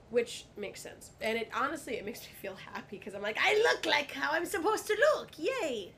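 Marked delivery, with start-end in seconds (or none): 3.4-5.9 high, loud mock-cheer voice; silly voice. Guest happy to look like their parents, high silly cheering voice. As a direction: celebrate in a squeaky put-on voice. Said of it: "I don't know why I'm doing voices".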